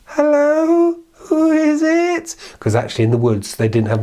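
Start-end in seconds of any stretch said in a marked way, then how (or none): 0.1-2.3 high, slow granny voice; old grandmother. Acted. The grandmother answers the knock, high and frail. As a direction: call out like a frail old lady.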